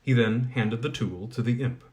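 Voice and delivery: usual speaking voice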